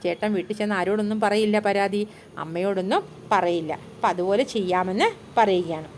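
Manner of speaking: ordinary talk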